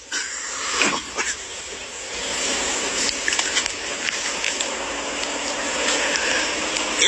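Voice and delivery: long unvoiced disbelieving laugh; bitter breathy laugh. Real, not acted. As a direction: laugh at the absurdity. Bitter laugh after complaint.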